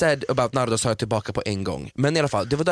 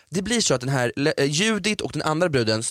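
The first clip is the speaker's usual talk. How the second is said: ordinary delivery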